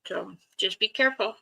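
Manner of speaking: talking through a mouthful; words around a bite of food; mouth full. Real, not acted. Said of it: "I need to talk with my mouth full".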